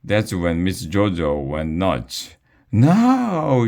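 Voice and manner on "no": much higher, louder shrieked no; a loud, high, frantic cry of no. A narrator's reading. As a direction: let out a desperate shriek. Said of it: "she shrieked".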